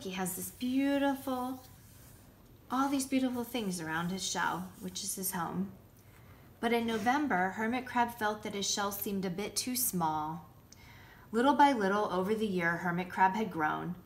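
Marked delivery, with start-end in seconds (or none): none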